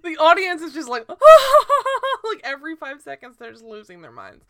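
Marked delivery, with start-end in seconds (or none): 1.1-2.2 imitated crowd roar. Audience losing their minds.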